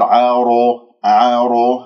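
عارو said with the lips rounded; rounded r, wrong. Shown as a demonstration. Teacher imitates the students' error. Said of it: "خطأ".

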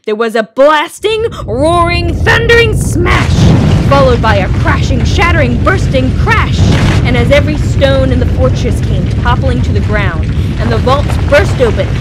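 booming, slowing on the big crash; building storyteller boom, stretched on thundering smash; dramatic loud narration. A narrator's reading. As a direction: roar the destruction out like thunder. Sounds of fortress crashing down.

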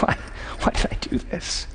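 soft, near-whispered self-questioning; imagined remorse, barely voiced; hushed regret. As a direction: ask it under your breath, regretfully. Imagined regret before God.

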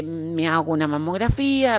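ordinary talk

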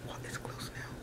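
very soft night-time whisper. Real, not acted. Giving up on the fly at bedtime, whispering. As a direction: keep it hushed, all in whisper. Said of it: "why am I whispering".

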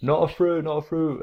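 put-on kids protesting. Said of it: "They're like".

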